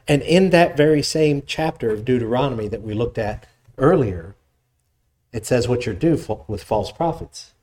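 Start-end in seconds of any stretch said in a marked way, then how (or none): none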